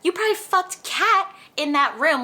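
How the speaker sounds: high, loud, scandalised outburst; outraged disbelief. Real, not acted. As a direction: blurt it out, properly scandalised. Speaker's outraged inner thought.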